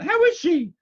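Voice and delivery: very high, loud demand; insistent. A narrator's reading. Aunt demanding news of the baby from the doctor, insistently.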